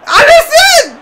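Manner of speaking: very high, loud yell of the player's name; exasperated yell. Real, not acted. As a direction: shout the name in disbelief. Yelling at his player.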